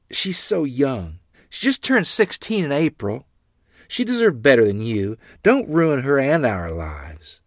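loud, high, anguished plea; distraught pleading. A narrator's reading. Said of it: "Mother wept quietly".

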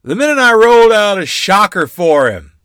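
high, loud boastful holler; gruff hoot. A narrator's reading. Great-uncle announcing a shocker from high above, hollering. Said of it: "Royal hooted gruffly".

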